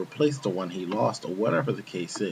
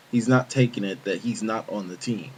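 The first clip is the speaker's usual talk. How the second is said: plain reading voice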